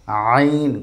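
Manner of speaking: আইন squeezed from mid-throat, long vowel; pressed throat sound. Shown as a demonstration. Teacher models Arabic letter, pressed throat sound. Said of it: "চাপ দিয়ে পড়ব".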